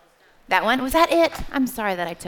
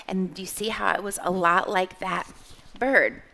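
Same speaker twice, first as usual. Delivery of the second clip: ordinary talk, nothing marked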